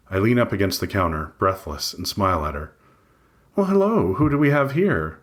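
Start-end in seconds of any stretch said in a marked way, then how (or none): 3.6-5.2 much higher, sing-song greeting; sweet receptionist. A narrator's reading. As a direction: greet him brightly like a sugary receptionist. Librarian woman greets him.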